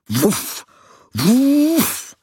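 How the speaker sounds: barks rising to a very high, loud yap; excited dog barks at the broom, building up; barking dog. Acted.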